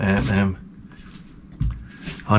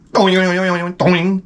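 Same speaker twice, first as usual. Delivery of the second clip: bouncing sound effect; 7 semitones higher, much slower than usual. Retold or imitated. Imitating head bouncing.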